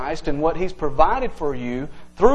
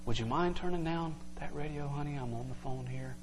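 much softer, polite and easy; gentle request; 3 semitones lower, 16 dB quieter, faster than usual. Retold or imitated. Parent asking a kid to turn down the radio, soft and polite. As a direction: ask sweetly, no pressure. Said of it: "Versus going and knocking on the door".

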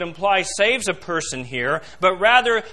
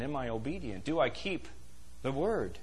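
much softer, low, inward asking; hushed self-questioning; 5 semitones lower, 13 dB quieter than usual. Acted. Preacher voicing each listener's own self-examination, hushed. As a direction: ask it quietly as if to yourself.

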